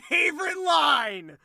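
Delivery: villain's hammed-up delight; mocking triumph. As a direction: gloat in sing-song mockery. Villain mocks her accusation.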